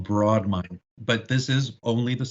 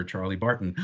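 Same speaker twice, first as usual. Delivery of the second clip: usual speaking voice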